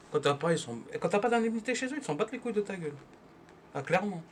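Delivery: usual speaking voice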